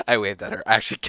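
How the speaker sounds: ordinary delivery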